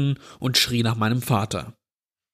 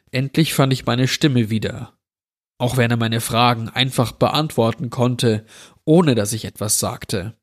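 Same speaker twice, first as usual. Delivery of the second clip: ordinary delivery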